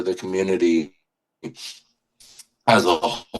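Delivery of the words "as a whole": real fight against crying, trailing into a long pause; holding back tears. Real, not acted. Speaker overcome talking about their group's message, holding back tears. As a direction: choke back tears as you finish. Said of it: "I'm trying not to cry".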